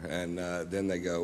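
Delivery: normal talking voice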